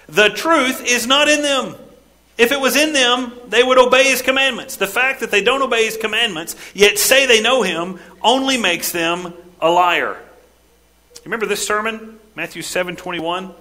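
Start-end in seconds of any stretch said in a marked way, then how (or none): 0.1-10.2 high and loud, driving each clause; preacher pressing the point home, loud and high; raised preaching voice. Acted. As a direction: keep preaching at full force. Preacher voicing John's verdict.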